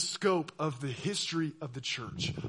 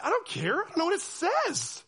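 much higher, whiny protest; exasperated churchgoer; 12 semitones higher than usual. Retold or imitated. Listener complaining about Latin scripture, high whiny. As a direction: throw up your hands in frustration. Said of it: "You're like".

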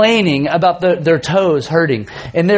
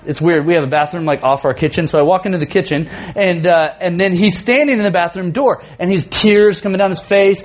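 ordinary talk, nothing marked

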